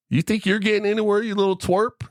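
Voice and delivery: high mocking big-guy taunt; taunting bully. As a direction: sneer it down at the little guy. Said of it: "sees me, and he goes".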